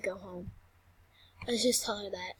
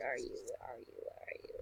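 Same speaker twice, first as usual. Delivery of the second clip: whisper; 16 dB quieter, faster than usual. Real, not acted. Searching quietly.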